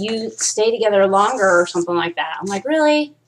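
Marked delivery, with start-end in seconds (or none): 2.7-3.1 higher-pitched sceptical question; retold incredulous reaction; mock disbelief. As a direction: react as if you barely buy it. Quotes own reaction to the claim.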